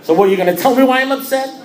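loud, very high incredulous question; indignant challenge. Retold or imitated. Member daring his sponsor to explain, indignant. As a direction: dare him with rising outrage.

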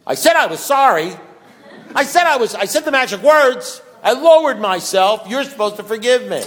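mock self-righteous yell demanding to be forgiven; indignant outburst. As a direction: shout it like the drunk who said the magic words.

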